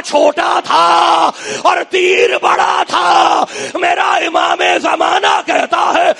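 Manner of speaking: loud, chanting lament, half sung; full-force majlis preaching. Acted. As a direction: cry the martyrdom story out to the mourners. (پوری شدت سے مجلس کا مصائب پڑھنا)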